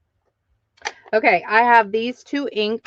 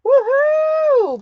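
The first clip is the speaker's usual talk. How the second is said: very high, loud whoop; excited woohoo; 5 dB louder than usual. Real, not acted. Host celebrating a buyer's purchase, loud high whoop. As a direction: whoop like your team just scored.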